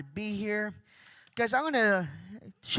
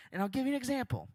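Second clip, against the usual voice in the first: usual speaking voice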